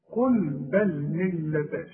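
"قل بل ملة" slow, the doubled lam of "ملة" pressed firmly; stressed doubled letters. Shown as a demonstration. Teacher showing the pressed doubled lam in "قل بل ملة". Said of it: "اتك على الحرف علشان يبان".

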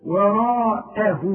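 وراءه, slow and loud, lips spread as in a smile; teacher demonstrating the lip position. Shown as a demonstration. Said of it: "رجع شفايفك على هيئة ابتسامة".

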